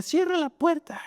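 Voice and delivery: mock child's high-pitched command; bossy child. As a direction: pitch way up into a bratty child's order. Angry nephew demands.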